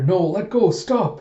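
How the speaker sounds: high, loud, lightly voiced frightened girl; weak girl pleading. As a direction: plead like a small scared girl being dragged off. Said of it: "small, weak girl from the sound of her".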